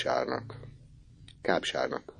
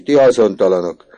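much louder and higher angry yell; furious shout; 9 semitones higher, 12 dB louder than usual. Acted. Teacher yells at the two boys from the window, furious. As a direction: tear into the lazy boys. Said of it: "kiáltott ki".

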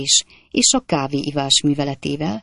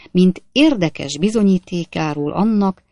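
plain reading voice

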